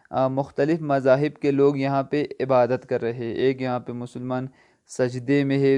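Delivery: ordinary talk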